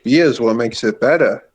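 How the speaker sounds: put-on Boston accent with dropped R's; thick-voweled Boston mom accent. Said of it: "do another thing in that accent".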